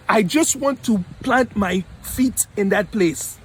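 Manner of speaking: emphatic, deliberate mock Nigerian accent; fake Nigerian accent. Playing a student bound for America, fake Nigerian accent. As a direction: put on a hammed-up Nigerian accent, word by word. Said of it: "That's my fake Nigerian accent".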